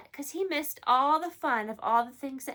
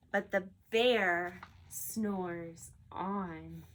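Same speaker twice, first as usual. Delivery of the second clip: sleepy refrain; 6 semitones lower, much slower than usual. A narrator's reading. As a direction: drawl it out like a snoozing bear. Story refrain, sleeping bear.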